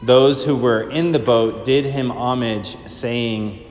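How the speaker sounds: slow, measured liturgical reading; solemn Gospel reading. Acted. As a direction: proclaim it steadily from the ambo.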